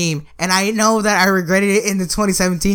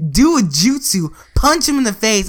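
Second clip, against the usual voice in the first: ordinary talk, nothing marked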